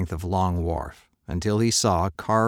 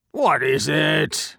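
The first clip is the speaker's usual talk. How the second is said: slow, louder demand from the merchant; 8 semitones higher, 4 dB louder, much slower than usual. Acted. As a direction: bark out a slow challenge. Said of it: "he demanded".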